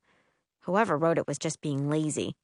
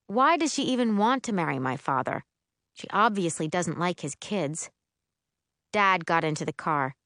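usual speaking voice

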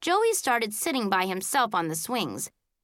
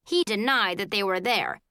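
ordinary delivery, nothing marked

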